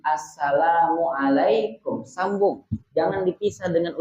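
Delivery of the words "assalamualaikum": Assalamualaikum said slowly in one unbroken breath; slow connected demo. Shown as a demonstration. Teacher shows joined greeting, slow and unbroken. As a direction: join Assalamualaikum smoothly, no gap. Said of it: "menyambung di antara dua kalimat".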